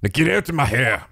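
scornful Irish brogue; dismissive Irish governor. Acted. Governor sends the lawyer away, in a scornful Irish accent. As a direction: brush him off in an Irish accent.

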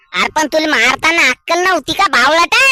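loud insulting rebuke; angry shout. Acted. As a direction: bark the insult at the fool. Insulting neighbour in quarrel.

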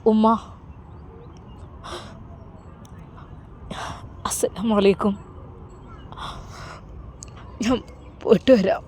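a groom holding back tears as he says goodbye; choked, understated sobbing; sobbing farewell. A narrator's reading. Son saying goodbye to his mother, quietly tearful. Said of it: "അവൻ വിതുമ്പുന്നുണ്ടായിരുന്നു".

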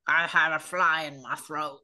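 low croaking frog; deep guttural frog; throaty frog voice. Acted. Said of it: "lowering your voice into your throat".

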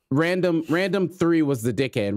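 normal talking voice

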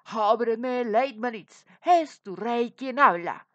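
loud royal command at the door; king shouting through the door. A narrator's reading. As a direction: bellow it like an angry king. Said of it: "gritó".

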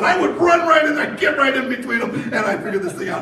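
high, loud, laughing eagerness; eager Josh. Retold or imitated. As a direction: mimic a kid eager to jump in. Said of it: "And Josh said".

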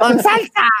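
high, loud vendor cry; vendor's yell. Retold or imitated. Vendor calling out to customer, high yell. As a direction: bark out the order like the stall lady. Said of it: "me decía".

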